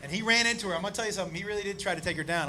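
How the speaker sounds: normal talking voice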